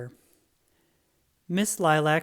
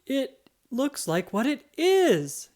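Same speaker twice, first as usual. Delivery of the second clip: high, slow triumphant exclaim; 9 semitones higher, 5 dB louder, slower than usual. Acted. Weasel catches Roy out and exclaims in triumph. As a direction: announce it in triumph. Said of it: "exclaimed Weasel".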